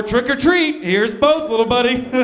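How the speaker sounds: lightly acted doorstep host; cheery candy giver. Greeting trick-or-treaters on Halloween.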